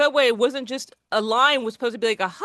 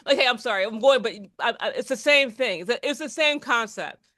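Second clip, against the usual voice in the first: ordinary delivery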